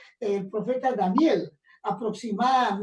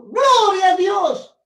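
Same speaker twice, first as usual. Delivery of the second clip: higher, louder, slow exclaim of praise; joyful shout; 6 semitones higher, 8 dB louder, slower than usual. Retold or imitated. Healed young man shouting his first praise, joyful loud cry. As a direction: burst out praising God.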